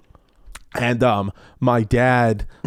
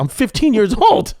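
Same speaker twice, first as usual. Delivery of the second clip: higher-pitched acted teenage disbelief; incredulous protest; 9 semitones higher, 4 dB louder than usual. Son objects to dad handing him his job, incredulous. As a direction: protest it, voice jumping up.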